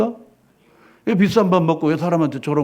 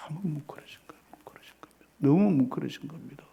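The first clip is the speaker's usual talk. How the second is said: hushed, breathy whisper; moved whisper; 2 semitones lower, 10 dB quieter than usual. Real, not acted. Pastor describing Peter deeply moved, whispered.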